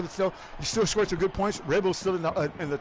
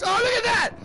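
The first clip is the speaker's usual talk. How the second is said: excited play-by-play; 6 semitones higher than usual. Acted. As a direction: shout out the crash as it happens. Calls a wreck live.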